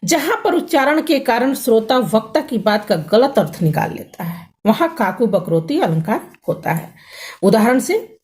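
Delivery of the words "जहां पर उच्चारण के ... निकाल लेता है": ordinary delivery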